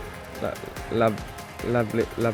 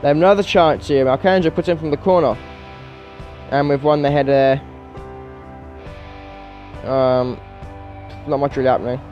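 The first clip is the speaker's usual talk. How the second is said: higher-pitched football commentary; live play-by-play; 5 semitones higher, 5 dB louder than usual. Real, not acted. Gamer calling his team's early concessions, live play-by-play. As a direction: call the match live as it unfolds.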